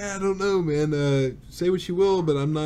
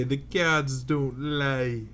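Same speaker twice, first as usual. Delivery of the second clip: slow mock quote; much slower than usual. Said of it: "I'm ready for him to say".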